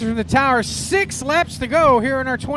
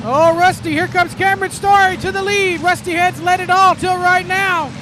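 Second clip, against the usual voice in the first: lively live race call; motorsport commentator; 6 semitones higher, 7 dB louder than usual. Real, not acted. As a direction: call the laps as they unfold. Live race call after restart.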